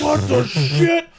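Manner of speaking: jolted out of sleep and baffled; startled awake. Retold or imitated. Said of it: "I was like".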